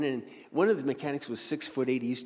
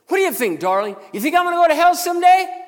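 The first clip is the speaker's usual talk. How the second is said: imitating a high, bellowed taunt; ferocious challenge; 16 dB louder than usual. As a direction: yell it as a rowdy mechanic daring you. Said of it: "very ferociously asked me".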